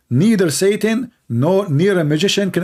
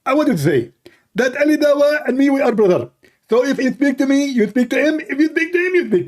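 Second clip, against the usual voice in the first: higher mock debater voice; pitched-up preacher voice; Zakir Naik; 7 semitones higher than usual. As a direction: parody of a preacher explaining. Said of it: "the voice of Zakir Naik".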